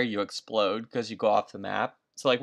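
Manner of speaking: normal talking voice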